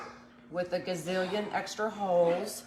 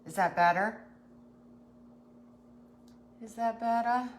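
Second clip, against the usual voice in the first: talking up; 3 semitones higher, 3 dB louder than usual. Real, not acted. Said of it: "Do I need to talk up?".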